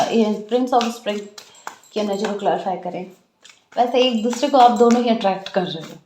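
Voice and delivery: usual speaking voice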